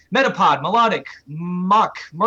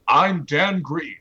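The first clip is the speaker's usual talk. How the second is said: lightly put-on, slow joke voice; Dan Green; much slower than usual. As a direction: draw it out as a joking self-introduction. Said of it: "Entei is a joke".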